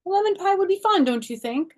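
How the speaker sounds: very high, loud coaxing; sweet-talking. Acted. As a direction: charm her into baking. Child sweet-talking mother.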